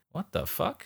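hushed, high baffled mock reaction. Film fan voices Rupert's baffled look, hushed and high. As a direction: mouth a stunned what the fuck. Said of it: "kind of looking like".

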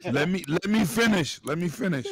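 insistent, loud. Real, not acted. Friends keep cutting in, loud insistent let-me-finish. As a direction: loudly demand the floor.

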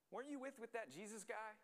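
put-on high-pitched girl's question; girl bystander. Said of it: "a girl looks and notices him and says".

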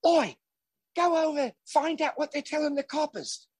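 put-on bossy London accent telling the translators; mock cockney command; Sharon's accent. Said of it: "Sharon goes to the translators".

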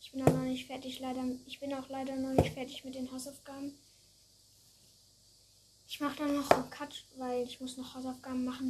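ordinary delivery

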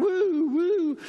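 high whooping cheer; hammed-up gleeful hoot; mock whoop. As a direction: whoop like a fan in the stands. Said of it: "You're like".

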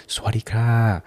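softly imitating a shop clerk's Thai hello; a gentle, lightly voiced Thai greeting; polite Thai clerk greeting. Clerk greeting a shopper at a seven eleven, soft polite imitation. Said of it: "they're like".

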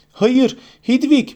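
high, slow desperate cry; anguished cry. A narrator's reading. Harry cries out as Hedwig falls, anguished. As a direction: scream after the falling owl.

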